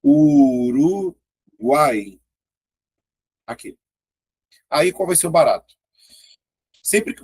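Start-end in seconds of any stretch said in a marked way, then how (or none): 0.0-1.2 Uruguai stretched very slowly, syllable by syllable; slow syllable split. Shown as a demonstration. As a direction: drag out Uruguai so each vowel sounds. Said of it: "Vai ficar assim, ó".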